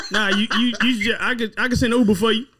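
laughing while talking. Real, not acted. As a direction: talk through real laughter. Laughing while inviting friend over.

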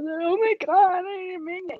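retold tearful apology, pitched high; near tears. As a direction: plead as if about to cry. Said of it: "trying not to cry".

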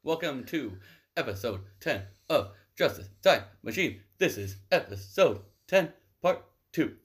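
host welcoming listeners back; show intro. Acted. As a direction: open the show like a radio host. Host opens the episode.

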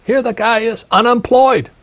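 a mock-outraged son who can't believe it; incredulous retort. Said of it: "I said".

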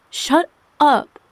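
high, loud outburst; hurt, explosive command; angry shout. A narrator's reading. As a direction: yell it, stung by ridicule. Ridiculed wife shouts at husband.

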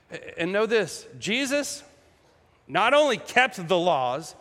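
ordinary talk, nothing marked

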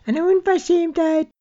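put-on high, outraged elderly voice; indignant old lady. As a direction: sputter it like a scandalised pensioner. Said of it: "erregte sich ein älteres Ehepaar".

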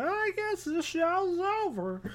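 a mock bystander shrugging it off; lightly voiced fan giving up; imagined listener. Imagined listener after host dies.

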